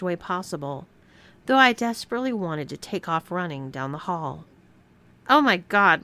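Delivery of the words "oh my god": loud amused gasp of disbelief; laughing exclaim. Acted. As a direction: blurt it out through a laugh. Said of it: "laughed Stephanie".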